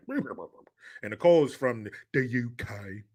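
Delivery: ordinary talk, nothing marked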